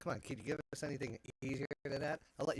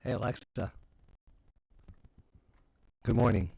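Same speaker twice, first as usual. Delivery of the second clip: talking to voice assistant; 9 dB louder than usual. Real, not acted. Commanding Alexa smart speaker.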